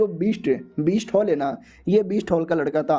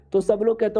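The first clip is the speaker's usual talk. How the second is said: usual speaking voice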